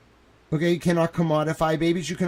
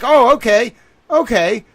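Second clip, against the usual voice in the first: mock surprise; 7 semitones higher, 7 dB louder than usual. Mocking donor's answer on call.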